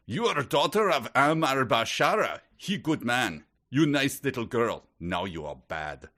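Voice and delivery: put-on thick Eastern European accent, high and loud; hammed-up heavy Hungarian accent. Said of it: "here's how I picture it".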